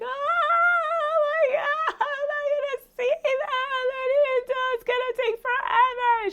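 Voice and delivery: very high, drawn-out mock wail; imitated hysterical screaming. Daughter freaks out over dead dogs, hammed-up screaming. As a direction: shriek it slowly like a child freaking out. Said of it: "She just starts screaming".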